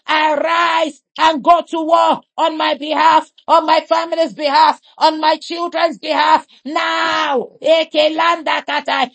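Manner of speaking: ordinary talk, nothing marked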